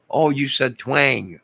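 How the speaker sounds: the penny drops; dawning surprise; sudden realization. Real, not acted. Realizing misheard twang as Twain.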